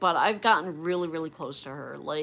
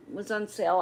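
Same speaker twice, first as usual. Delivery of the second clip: usual speaking voice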